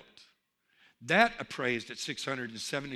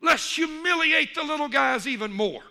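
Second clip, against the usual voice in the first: loud, raised-pitch locker-room rallying yell; coach's yell; 6 semitones higher, 6 dB louder than usual. Acted. Coach rallying big animals at halftime, yelling. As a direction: fire up the team at full volume. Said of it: "the coach for the big animals yelled".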